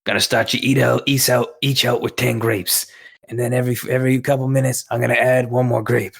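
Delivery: put-on gruff Brooklyn accent, smoke-addled. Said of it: "like a Brooklyn accent".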